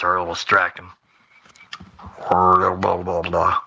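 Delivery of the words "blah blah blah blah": normal talking voice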